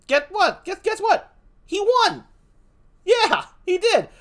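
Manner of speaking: spring the twist with glee; excited reveal. Acted. Teasing twist of the story.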